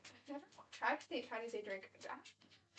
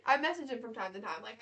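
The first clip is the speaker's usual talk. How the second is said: normal talking voice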